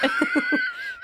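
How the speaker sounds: high demo laugh. Shown as a demonstration. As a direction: let out a quick ha ha. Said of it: "I'd be a ha ha".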